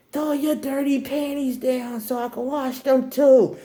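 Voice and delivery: high, slow drawn-out holler; mom yelling. Retold or imitated. Mom calls for the dirty laundry, yelling. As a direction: imitate mom hollering it down the house. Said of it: "She literally yelled".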